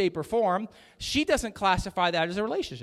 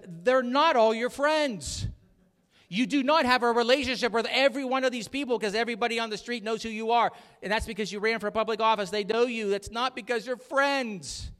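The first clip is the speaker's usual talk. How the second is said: higher, louder scolding wife voice; 6 semitones higher, 4 dB louder than usual. Retold or imitated. Pastor imitating his wife scolding him. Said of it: "My wife scolded me".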